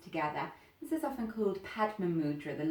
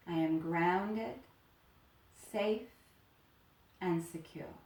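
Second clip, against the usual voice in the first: slow affirmation; slower than usual. Acted. Teacher giving students an affirmation to repeat, slow and soothing. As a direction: let each word land, then rest. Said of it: "thinking to yourself".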